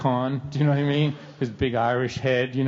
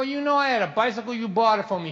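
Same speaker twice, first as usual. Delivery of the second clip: higher, quick defensive reply; defensive kid; 9 semitones higher, faster than usual. Retold or imitated. Son defending himself to his angry dad, high and quick. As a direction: answer like a cornered kid.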